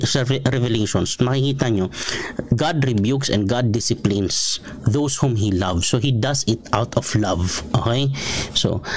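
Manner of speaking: usual speaking voice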